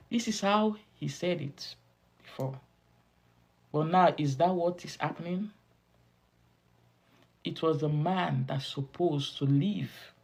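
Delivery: ordinary talk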